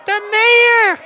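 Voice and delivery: very high, loud crowd shout. Acted. Protester shouting back to the orator, very high and loud. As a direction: yell the answer from the mob. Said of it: "someone shouts".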